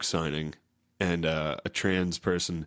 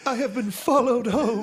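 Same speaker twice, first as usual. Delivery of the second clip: pitched way up, louder, laughing; high mock wail; 6 dB louder than usual. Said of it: "I love how you play that".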